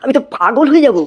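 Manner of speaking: high-pitched comic outrage; exasperated disbelief. Acted. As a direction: burst out high, appalled at what you hear. Teacher appalled by colleague's mispronunciation.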